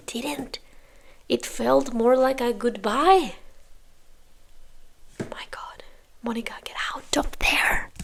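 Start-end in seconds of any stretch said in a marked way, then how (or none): 5.2-7.9 urgent hushed whisper; whispered plea for Monica to leave. Real, not acted. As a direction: whisper to the screen, pleading. Urging contestant in whisper.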